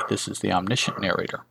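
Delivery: usual speaking voice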